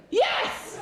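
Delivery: very high, ecstatic cry; hammed-up American whoop; mock American enthusiasm. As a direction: shriek it like an eager convert. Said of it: "you know what Americans are like, they'll just go".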